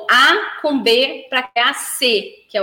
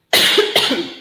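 lingering cough. Real, not acted. Lingering cough after choking.

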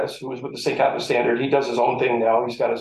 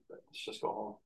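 whispered; 3 semitones lower, 17 dB quieter than usual. Retold or imitated. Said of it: "And I'm like".

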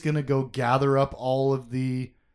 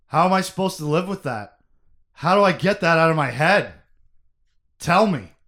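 loud, higher-pitched anguished plea; tormented outburst, acted; anguished grief; 4 semitones higher, 8 dB louder than usual. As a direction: act out grief, building to a loud demand. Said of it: "representation of this guy's grief".

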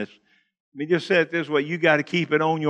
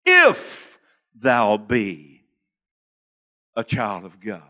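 much lower, very slow, sly doubting whisper-like tone; insinuating devil; 10 semitones lower, much slower than usual. Retold or imitated. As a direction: plant a seed of doubt, lightly voiced tempter. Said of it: "put a little seed of doubt".

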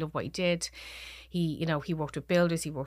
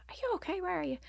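retold worry, higher and softer; worried questioning; 13 semitones higher, 4 dB quieter, faster than usual. Wife phoning her missing husband, worried. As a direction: ask with concern, voice lifted high.

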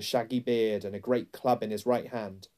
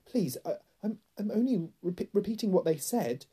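put-on high, frightened boy; stammering; 10 semitones higher than usual. A narrator's reading. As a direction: plead nervously, stumbling over words. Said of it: "stammered Edmund".

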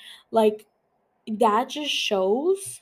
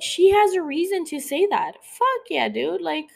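much higher, emphatic outburst; heated agreement; 8 semitones higher, 4 dB louder than usual. Real, not acted. Podcaster siding with a wronged wife, heated agreement. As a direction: back her up with rising indignation.

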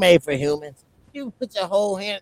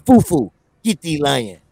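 African voice; 3 semitones lower, 4 dB louder than usual. Retold or imitated. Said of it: "in the African voice".

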